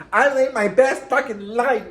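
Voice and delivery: high, loud tipsy brag; drunk sloppy boast. Retold or imitated. Bragging drunk to her ex, high and loud. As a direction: belt it like you're drunk at the club. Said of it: "probably like that".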